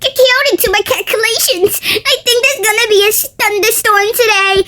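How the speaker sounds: put-on high, nerdy voice of a smart kid; know-it-all kid. Smart kid predicts the storm in a high nerdy voice. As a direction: announce it like a little genius.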